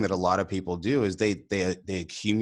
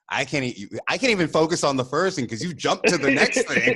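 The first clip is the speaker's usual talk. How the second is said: exasperated retort; 9 semitones higher, 4 dB louder than usual. Retold or imitated. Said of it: "I'm like".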